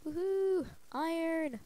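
high, loud joyful whoop; excited cheer. Real, not acted. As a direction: cheer like you struck treasure. Found iron while mining.

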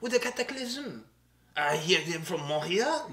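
a light mock French accent. Host teasing co-host, light mock French accent. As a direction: keep the playful French accent going. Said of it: "with my French accent".

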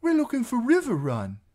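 put-on very high, loud boy's voice; eager piping. A narrator's reading. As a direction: pipe up like a keen lad. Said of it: "Hot Pie piped up eagerly".

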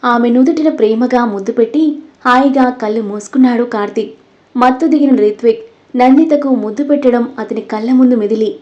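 usual speaking voice

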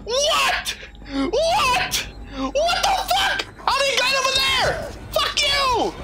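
high, laughing, disbelieving shouts; shocked outburst. Real, not acted. Gamer shouts at an enemy that warped behind him, high disbelieving yell.

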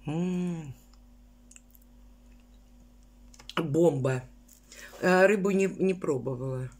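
chewing while talking; speaking between bites; mouth full. Real, not acted. Seen 1: bites cheese pancake, chews with full mouth while speaking.

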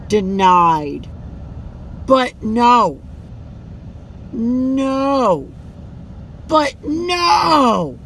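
builds from a firm no to a loud shout; playful shouted refusal. Acted. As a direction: turn each no up until you're yelling. Play-fight, refusing a friend.

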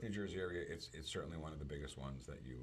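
normal talking voice

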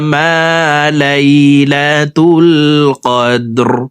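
slow, drawn-out melodic chant; slow tajweed chant; Quran recitation. Acted. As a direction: intone each word long and reverent.

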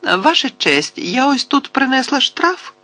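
put-on very high, innocent girlish voice; high-pitched, guileless little voice; innocent thin little voice. A narrator's reading. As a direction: pipe up thinly, all innocence. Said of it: "невинним тоненьким голоском промовила".

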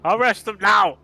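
flustered, frantic ruler yelling; stammer, then shout in panic; panicked shouting. Acted. Panicked mayor shifts the blame.

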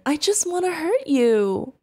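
normal talking voice

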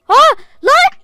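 sudden high, loud yelp of fright; startled shout. Real, not acted. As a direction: jump out of your skin. Ani tehlikeye çığlık. (korkuyla ani bağırış)

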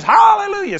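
high, loud cry of praise; sudden high shouted praise; shouted hallelujah. Retold or imitated. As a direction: burst out with it. Woman shouting in service.

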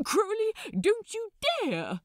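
horrified protest. Acted. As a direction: plead in panic. Angel dragged toward stage protesting.

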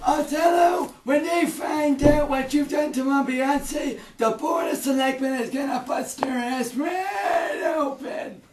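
angry shout. Acted. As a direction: shout the threat at Othello. Father yells at Othello.

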